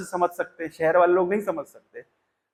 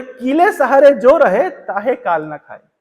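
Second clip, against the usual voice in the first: slow verse recital; 3 semitones higher, 4 dB louder, slower than usual. Acted. Reciting the couplet line again.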